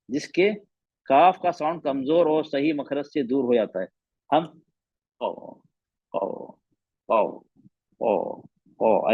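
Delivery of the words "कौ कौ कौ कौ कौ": कौ slow and weak, tongue barely raised; faint wrong qau; wrong weak version; weak qau demo. Shown as a demonstration. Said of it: "ऐसे आवाज ही नहीं बराबर आएगी".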